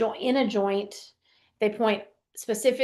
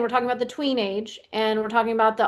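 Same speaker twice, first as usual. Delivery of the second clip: ordinary talk, nothing marked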